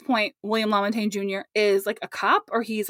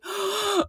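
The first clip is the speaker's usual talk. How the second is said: mock gasp; 9 semitones higher than usual. Reacting to the retold kidnapping scene.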